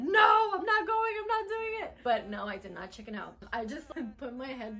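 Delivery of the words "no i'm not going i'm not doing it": high, loud near-crying no; panicked refusal. Retold or imitated. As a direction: freak out and refuse, almost in tears. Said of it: "would have freaked out and said".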